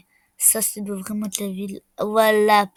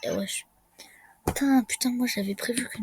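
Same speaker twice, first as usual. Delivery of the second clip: normal talking voice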